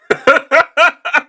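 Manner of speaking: high, loud laugh cracking out; sudden high laugh; loud burst of laughter. Real, not acted. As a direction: laugh loud at the absurd claim. Laughs at pronoun claim.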